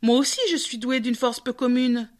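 usual speaking voice

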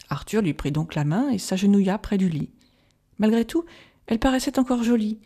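ordinary delivery

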